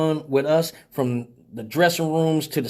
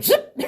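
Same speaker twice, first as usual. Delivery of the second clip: mock cutoff zip. DJ cutting the music off.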